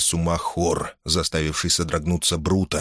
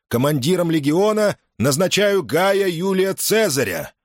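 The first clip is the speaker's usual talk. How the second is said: roaring rallying speech; 11 semitones higher than usual. A narrator's reading. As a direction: proclaim it to the ranks. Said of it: "обратился к солдатам".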